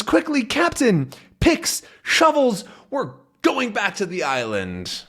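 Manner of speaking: high, eager orders; urgent. Acted. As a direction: rush them to the island.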